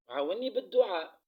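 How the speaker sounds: loud, pressing call for prayers; loud plea. Real, not acted. To her viewers asking them to pray, loud plea.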